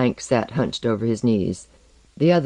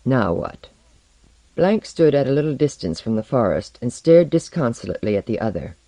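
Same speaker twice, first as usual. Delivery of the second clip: usual speaking voice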